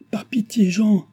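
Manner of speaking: high, drawn-out plea; crying out. A narrator's reading. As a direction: cry for pity. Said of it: "cria Chon".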